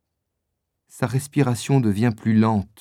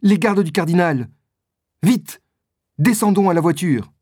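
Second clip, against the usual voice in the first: higher-pitched call of alarm; urgent, raised-pitch order; urgent cry; 6 semitones higher, 4 dB louder than usual. A narrator's reading. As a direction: sound the alarm and hurry them along. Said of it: "crie Milady".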